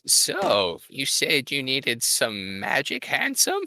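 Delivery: put-on high, cackling old crone. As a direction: purr it like a sly old hag. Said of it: "old crone voice sounds like Adam Sandler".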